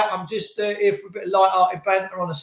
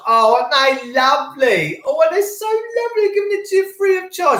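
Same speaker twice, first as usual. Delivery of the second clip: rising into a high, syrupy mock coo; sarcastic gushing; 8 semitones higher than usual. Host sneers at officials offering free tests, sarcastic gushing. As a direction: gush in a soaring, fake-grateful voice.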